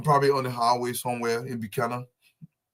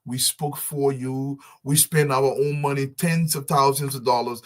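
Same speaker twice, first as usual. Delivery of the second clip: usual speaking voice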